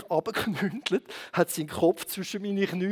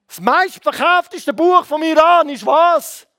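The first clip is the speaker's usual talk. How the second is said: high, very loud quiz question and answer; calling out; 7 semitones higher, 8 dB louder, slower than usual. Real, not acted. Preacher quizzing the congregation about Iran, calling out. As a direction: boom it out slowly to the whole room.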